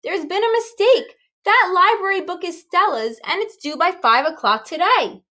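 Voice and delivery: a hint of a flustered mother; urgent mother calling after the scouts. A narrator's reading. Mother chasing scouts, pleading.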